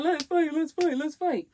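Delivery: mock taunting. Mocking the guy's challenge.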